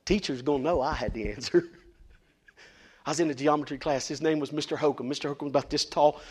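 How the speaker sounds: ordinary delivery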